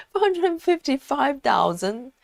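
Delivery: laughing in disbelief. Real, not acted. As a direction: laugh through the number, amazed. Amazed at a huge stitch count.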